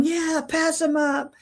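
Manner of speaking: lightly mimicked chorus of approval; retold egging-on. Retold passengers egging her on.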